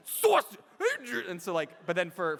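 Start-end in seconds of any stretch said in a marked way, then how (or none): 0.1-1.2 very high, loud cartoon squawk; [name] doing Stewart. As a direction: burst into the zany high character voice. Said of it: "get into character is by saying sausage".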